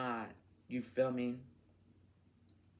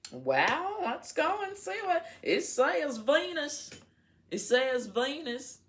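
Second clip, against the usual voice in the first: excited; 12 semitones higher, 5 dB louder than usual. Real, not acted. Excited reading the card.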